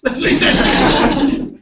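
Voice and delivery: high, slow laughing narration. Real, not acted. Speaker cracks up retelling the Texas cop story, laughing.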